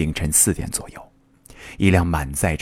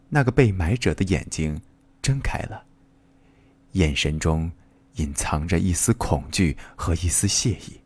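plain reading voice

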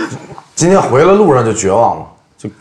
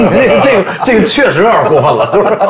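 director voice, laughing; 10 semitones higher, 4 dB louder than usual. Retold or imitated. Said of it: "导演说".

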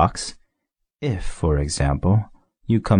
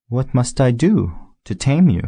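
higher eager question; little prince; 6 semitones higher than usual. A narrator's reading. Little prince asking the fox, higher and eager. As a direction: ask it eagerly, wanting to learn. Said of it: "asked the little prince".

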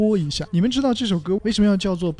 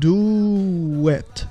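slowed-down demo; 2 semitones higher than usual. Shown as a demonstration. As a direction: DO IT stretched very slowly with a w glide. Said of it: "我读慢一点". (DO IT 放慢连读示范)

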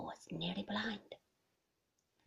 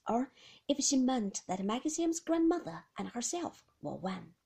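ordinary delivery

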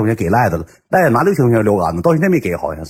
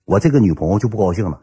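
normal talking voice

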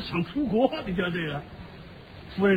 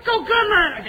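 mock bird voice; 11 semitones higher than usual. Bird approves the gifts.

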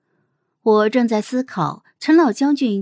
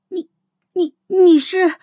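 stunned stammer; 9 semitones higher, 4 dB louder than usual. Acted. Xiao girl recognising the disguised guard, stunned and faltering.

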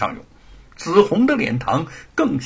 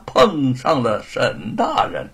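ordinary delivery

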